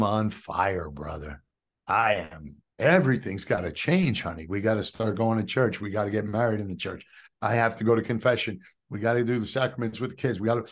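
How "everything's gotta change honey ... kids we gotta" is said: fast, eager rush of words; tumbling it all out to his wife, breathless with zeal; word vomiting. Retold or imitated. Husband blurting plans to wife in bed, fast and eager.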